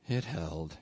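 low, soft murmur; subdued, barely voiced reply. A narrator's reading. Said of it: "he murmured".

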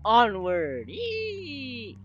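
high, loud charge call rising into a long squeal; hammed-up rallying shout; playful battle cry. Battle cry setting off in game.

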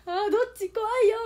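高く大きく叫ぶおどけた怖がり; 高い声で大げさに怖がる; 大げさな困惑. Retold or imitated. 困惑した心の声. (大げさにおびえた叫び)

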